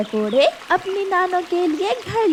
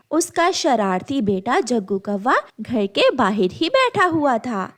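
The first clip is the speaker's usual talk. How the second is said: ordinary delivery, nothing marked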